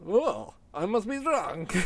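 put-on high, slow comic Russian accent. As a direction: play a tipsy Russian henchman in a Russian accent. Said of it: "Yeah, in a Russian accent".

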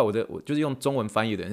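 ordinary talk, nothing marked

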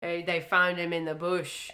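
loud mock Australian; a hammed-up Aussie bushman. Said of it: "Steve Irwin".

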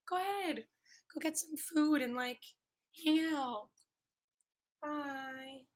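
high, sing-song pet voice; cooing to pet. Real, not acted. Podcaster coaxing her cat to leave, cooing.

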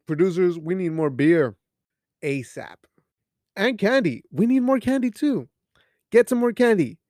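loud, high holler; mock demand. Host demanding beer and candy from producers, loud mock demand.

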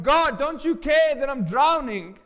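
loud, high, slow cry for help; panicked plea. Acted. Believer crying to God in a storm, panicked. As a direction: wail it upward in acted desperation. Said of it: "And instead of going".

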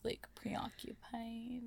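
whisper. Real, not acted. Dreamy aside.

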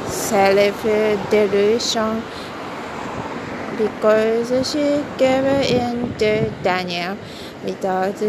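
loud, projected narration; loud story reading. Acted. Reading a novel aloud to a listener, projected loudly. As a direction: read it out boldly to the room.

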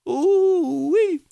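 very high, drawn-out "ooh-wee"; delighted whoop. Real, not acted. Preacher delighted by the verse.

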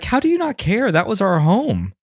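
put-on a touch of a hurt young girl; high upset girl. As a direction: plead like an upset kid. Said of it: "she's like".

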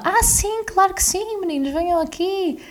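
very high, gushing mock celebrity voice; a sugary high imitation of the TV host; Cristina's sweet reply. Cristina Ferreira welcomes the kids, high gushing imitation. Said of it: "E ela".

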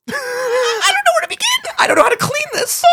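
hammed-up panic over the mess; mock panicked wail. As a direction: wail it like you're helpless. Retelling panic on the toilet.

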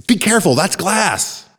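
sharp, loud and high warning cry; alarmed yell. A narrator's reading. Narrator yelling at neighbor about the glass table, loud and high. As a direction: shout a sudden warning. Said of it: "I yelled".

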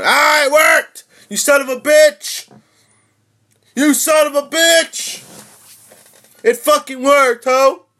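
full-voiced, very high victorious screams; excited screaming. Real, not acted. Streamer as the blocked app finally works, excited screaming. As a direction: scream in triumph at the top of your range. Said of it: "I'm gonna scream".

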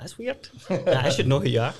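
a mock-baffled squeak of surprise; puzzled retold reaction. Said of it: "I was like".

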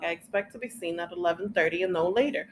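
usual speaking voice

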